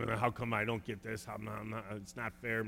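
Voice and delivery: a whiny little mutter of unfairness; grumbling. Retold or imitated. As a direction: grumble it under your breath like a sulky child. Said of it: "Muttering, grumbling".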